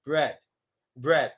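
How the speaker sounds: "bread" short and low, with a soft R; unprotruded R. Shown as a demonstration. Teacher modelling no protrusion on "bread", soft R. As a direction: keep lips back on the R of "bread". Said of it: "don't want to protrude on bread".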